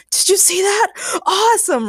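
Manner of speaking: high, thrilled character voice; excited holler. A narrator's reading. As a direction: holler in high-pitched amazement. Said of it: "Ray hollered, even more excited than usual".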